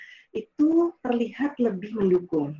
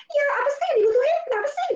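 high, annoyed tone; much faster than usual. Shown as a demonstration. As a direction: snap the question out impatiently, pitched way up. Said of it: "bukan intonasi yang tinggi yang kayak". (nada tinggi, cepat dan ketus)

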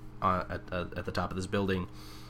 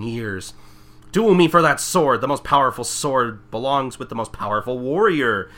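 louder, higher boastful warrior voice; Gankamaru exclaiming; 5 semitones higher, 7 dB louder than usual. Retold or imitated. Villain boasting to the girl, louder and grand. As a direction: proclaim like a pompous warrior. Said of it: "Gankamaru exclaims".

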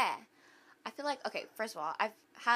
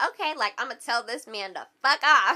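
tipsy-bold sass, raised and half laughing; sassy; 4 semitones higher, 7 dB louder than usual. Retold or imitated. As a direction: play up a sassy resolve through a laugh. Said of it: "I was feeling kinda sassy".

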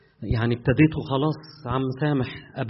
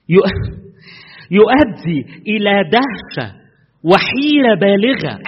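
acute agitation; 6 semitones higher, 6 dB louder, slower than usual. Shown as a demonstration. Preacher acting out the definition to the congregation, loud and agitated. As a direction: say يؤدي إلى دهشة slowly, loud and high, with acute agitation. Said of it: "بتنفعل أنت؟ حاد".

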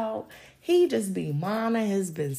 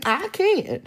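laughing; 5 semitones higher, 3 dB louder than usual. Real, not acted. Laughing boast.

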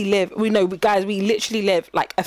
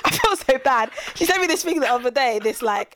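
ordinary delivery

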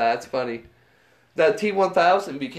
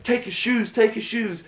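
gangster demand; 8 semitones higher than usual. Retold or imitated. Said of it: "they're like".

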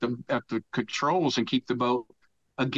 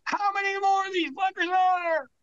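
very high, exasperated shout; retold group yell. Three crewmen to the captain above, yelling up. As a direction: holler up at the ship, re-enacting it. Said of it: "we yell up to this guy".

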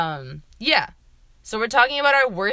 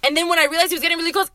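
ordinary delivery

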